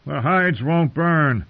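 usual speaking voice

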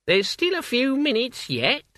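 put-on high, crackly old woman; old witch voice. A narrator's reading. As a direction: play an elderly witch, brisk. Witch voice in narration.

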